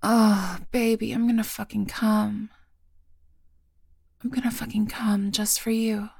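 breathless moaning. Acted.

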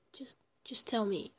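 normal talking voice

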